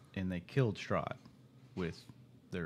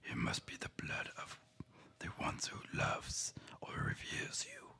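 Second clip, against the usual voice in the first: eerie ancient whisper of a dark power; gravelly whisper. Acted. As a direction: hush it out like a creeping voice from the shadows. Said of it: "things got a little quiet and a little gravelly".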